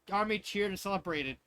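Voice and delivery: ordinary delivery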